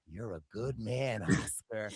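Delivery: very low, soft mock praise; Cirie impression. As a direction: imitate a cool boss, deep and hushed. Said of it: "Cirie was like".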